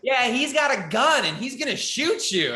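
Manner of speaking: put-on high, gleeful kid threat. Kid tells the comic his dad will shoot him, in a high gleeful voice.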